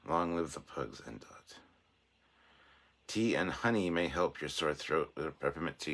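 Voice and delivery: ordinary talk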